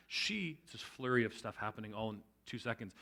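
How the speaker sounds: ordinary delivery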